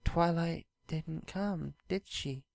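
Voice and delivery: slow, soft and downcast; sad. A narrator's reading. Luna asks Spike about Twilight, slow and sad. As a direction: let the disappointment sink in. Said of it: "looking sad".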